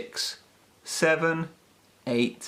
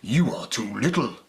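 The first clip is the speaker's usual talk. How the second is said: lower, gruff-ish dog reply; big dog voice; 3 semitones lower than usual. A narrator's reading. Big dogs turn Peppy away, lower big dog voice. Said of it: "they woofed".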